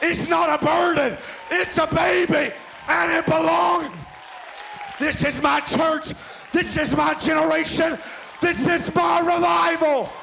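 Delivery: hollering from the pulpit about revival; preaching climax. Acted. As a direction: build to a fever pitch. Sermon climax, shouting.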